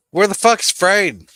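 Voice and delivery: high, loud yell for someone. Real, not acted. Streamer yelling out for Frayden, loud and high. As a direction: shout it out to find him.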